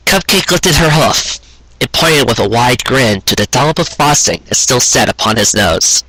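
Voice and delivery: usual speaking voice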